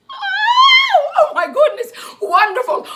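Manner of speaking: high, loud squeal of delight; delighted shout. Real, not acted. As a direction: burst out with joy. Delighted reaction to the song.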